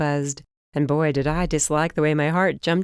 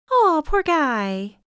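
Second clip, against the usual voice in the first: sympathetic; 11 semitones higher, 4 dB louder, much slower than usual. A narrator's reading. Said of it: "Holly sympathises".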